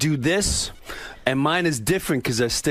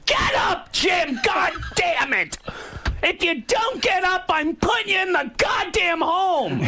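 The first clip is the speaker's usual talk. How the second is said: imitating mother yelling. Comedian acting out his mother screaming at his dad, mock yell. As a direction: scream it like a furious old mother. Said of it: "she'll be behind them going".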